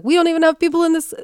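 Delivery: mis-voice the French president as a Russian; mock Russian accent. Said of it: "oh, that's, that's Russian, sorry".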